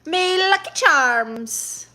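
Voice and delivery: high, loud, drawn-out mock Irish catchphrase; hammed-up bad Irish, stretched out; terrible Irish accent. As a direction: put on a badly faked Irish accent. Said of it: "It's a terrible Irish accent".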